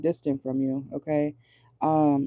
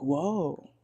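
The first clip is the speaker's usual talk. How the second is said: mock innocent surprise; 3 semitones higher, slower than usual. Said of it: "and then being like".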